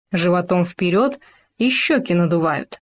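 ordinary delivery, nothing marked

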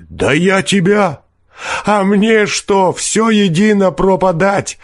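high-pitched, drawn-out raging; fierce desperate tirade. A narrator's reading. Ruined peasant defying the forester, fierce despair. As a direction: rage like a man with nothing to lose. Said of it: "подхватил мужик свирепым голосом".

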